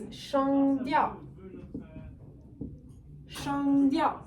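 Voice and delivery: "shangdiao" said slowly in Mandarin tones; Mandarin pronunciation. Shown as a demonstration. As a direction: spell out "shangdiao" with clear tones. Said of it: "tones in Chinese is shangdiao".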